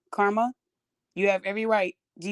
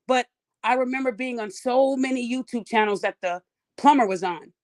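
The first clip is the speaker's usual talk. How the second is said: ordinary talk, nothing marked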